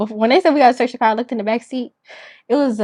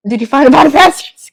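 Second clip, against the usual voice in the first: urgent worried check; 3 semitones higher, 7 dB louder, faster than usual. Retold or imitated. Acts her panicked check with police.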